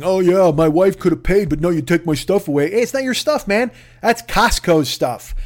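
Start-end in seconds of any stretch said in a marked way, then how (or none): 0.0-2.7 grousing. Retold or imitated. Customer grousing in his video, hammed-up sulky grumble. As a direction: grumble like a sore loser. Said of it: "he's b-, grousing".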